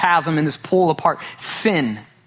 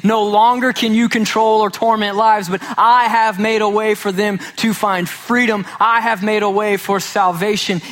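Jesus declaring triumph over Satan; triumphant proclamation; 6 semitones higher than usual. Acted. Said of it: "defeating Satan and saying".

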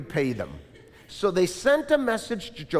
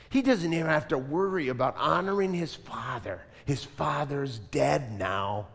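usual speaking voice

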